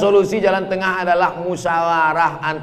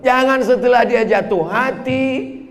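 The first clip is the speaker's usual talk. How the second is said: normal talking voice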